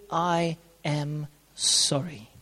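soft, slow, tender apology; gentle apology. Shown as a demonstration. Coaching couples to apologize, soft and tender. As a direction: say it gently to disarm them. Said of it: "in a tender, gentle manner".

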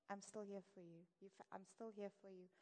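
quiet, steady comfort; hushed reassuring promise; soft reassurance. Acted. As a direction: promise gently you'll stay. Repeating reassurance to failed person.

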